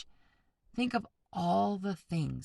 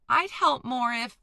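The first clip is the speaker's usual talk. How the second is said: high and loud, broken off; protesting, cut off; 8 semitones higher, 8 dB louder than usual. A narrator's reading. Boy keeps protesting to brothers, high and loud. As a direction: plead his case until he is cut off. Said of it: "Luke protested".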